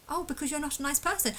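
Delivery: high, quick, snide mimicry; mocking snide voice. Mimicking accusers saying he is not nice, quick snide. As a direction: toss off their accusation mockingly.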